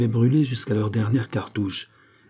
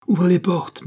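high, raised command; calling out an order; 9 semitones higher, 4 dB louder than usual. A narrator's reading. The king orders his guards to open up, calling out. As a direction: order it out across the room.

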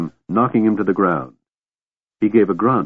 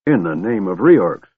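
plain reading voice

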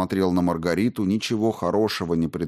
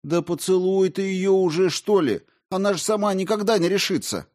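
exasperated; 11 semitones higher than usual. Acted. Margarita exasperated.

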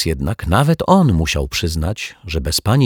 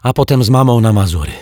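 fast, louder, higher puffed words; girl panting under heavy bags; puffing under bags; 6 semitones higher, 6 dB louder, faster than usual. A narrator's reading. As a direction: blurt it out while hauling luggage. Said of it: "wysapała Asia".

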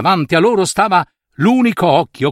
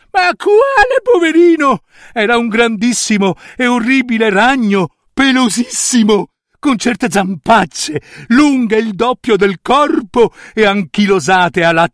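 disgusto indignato; 7 semitones higher than usual. Acted. Nutria disgustata descrive il ragno.

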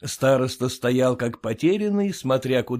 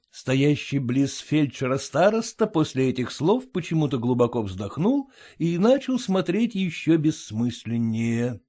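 plain reading voice

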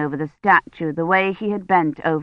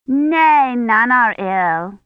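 put-on high little girl, louder on "Nay"; Agnes, emphatic child; 4 semitones higher, 3 dB louder, much slower than usual. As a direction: answer like a small child, drawn out. Child Agnes answers the priest.

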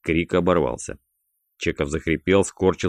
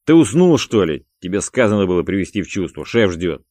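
angry raised-voice scolding; sudden anger; 3 semitones higher, 7 dB louder than usual. A narrator's reading. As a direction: explode at your partner, then press him. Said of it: "с неожиданным злом сказал Шакилу".

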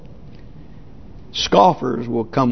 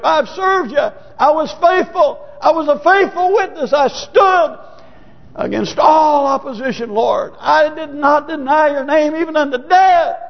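raised, high-pitched plea to God; impassioned protest; 10 semitones higher than usual. Acted. Martyr protesting faithfulness to the Lord, impassioned. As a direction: cry out a witness's indignant defense.

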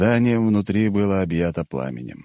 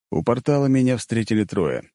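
ordinary delivery

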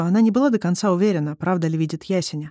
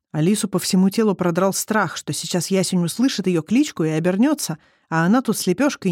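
usual speaking voice

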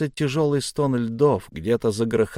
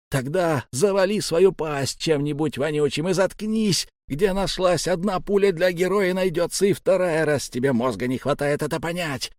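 raised pitch, strained scolding; angry berating; 5 semitones higher than usual. A narrator's reading. Sablya lecturing his comrade on staying alive, angry. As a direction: drive the threat home without shouting.

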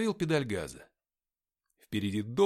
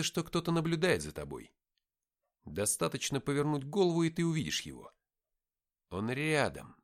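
usual speaking voice

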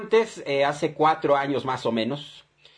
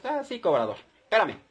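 airy, higher, teasing calm; mock unbothered; 8 semitones higher than usual. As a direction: brush him off sweetly. Deudor responde calmado al cobrador. (deudor burlón y tranquilo ante el cobrador)

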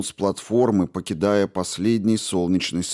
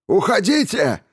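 very high, loud, strained yell; cracked-voice shout; 12 semitones higher, 5 dB louder than usual. A narrator's reading. Man orders the mob away, shouting with a broken voice. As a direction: scream the order till the voice breaks. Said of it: "крикнул Хусари сорванным голосом".

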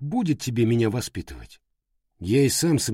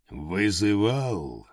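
slow, strange stone giant voice; inhuman thundering voice; 2 semitones lower, much slower than usual. Acted. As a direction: boom it out like living rock. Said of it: "гремит над окрестностью нечеловеческий голос".

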